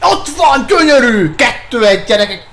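high, loud, laughing shouts; excited goal cheer. Real, not acted. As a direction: celebrate the go-ahead goal. Goal celebration while playing.

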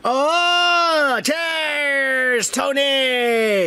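hearty high-pitched cheers; excited toast. Real, not acted. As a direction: call out the toast to Tony. Toasting a commenter.

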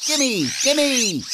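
very high, squeaky chick clamor; shouting chicks. Acted. Hungry magpie chicks begging their parents for food, high-pitched shouting. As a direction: squeal it like greedy baby birds. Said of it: "do the chicks shout".

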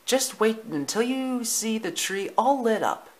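high, excited mother dog; enchanted Lady. Acted. Lady describes the lit tree, high and enchanted. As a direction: build anticipation in a high voice. Lady promises the puppies magic.

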